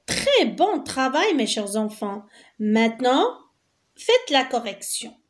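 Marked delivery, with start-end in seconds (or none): none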